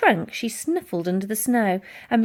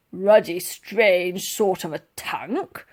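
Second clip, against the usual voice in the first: gruff soldier grumble; slower than usual. A narrator's reading. Sergeant major grumbles.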